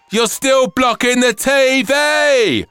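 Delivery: high, loud, slow whining moan.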